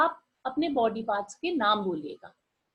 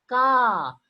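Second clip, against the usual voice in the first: loud syllable; 6 dB louder than usual. Shown as a demonstration. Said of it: "अब क की आवाज तेज आएगी".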